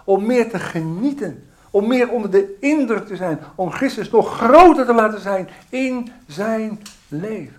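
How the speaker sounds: normal talking voice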